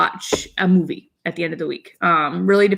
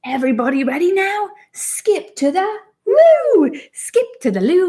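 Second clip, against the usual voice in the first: put-on slow, higher storybook British accent; 4 semitones higher, much slower than usual. Mom reads a potty book to her daughter, British accent. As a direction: read it like a cheery British picture book.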